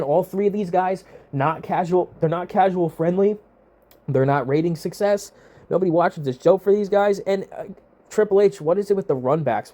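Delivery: normal talking voice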